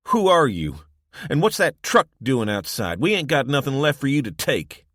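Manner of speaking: loud suspicious demand; hostile challenge. A narrator's reading. Man confronting visitors about their truck, loud and hostile. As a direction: push back at the strangers.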